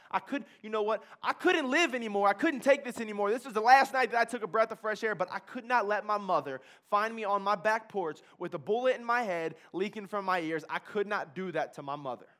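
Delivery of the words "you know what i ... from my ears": ordinary delivery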